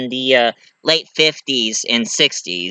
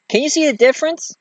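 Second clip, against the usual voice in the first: sarcastic; 12 semitones higher, faster than usual. Real, not acted.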